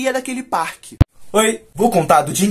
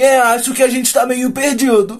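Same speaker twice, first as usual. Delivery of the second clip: slow, louder, higher mock admission; brazen deadpan; 4 semitones higher, 6 dB louder than usual. Know-it-all admitting to the gang he is lost, brazen and drawn out. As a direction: imitate a shameless friend owning up slowly. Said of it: "com a maior cara de pau".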